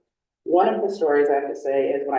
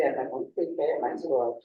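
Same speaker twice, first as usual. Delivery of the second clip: normal talking voice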